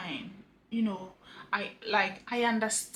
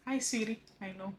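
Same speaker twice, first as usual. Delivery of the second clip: ordinary delivery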